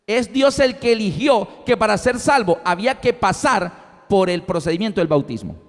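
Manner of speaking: ordinary delivery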